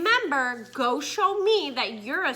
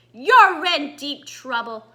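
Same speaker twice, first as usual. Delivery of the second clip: much louder, slow and stern; stern scolding; 7 dB louder than usual. Acted. Mother scolding Gilbert, slow loud warning. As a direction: lay down the law to her son. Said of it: "Gilbert's mother thundered".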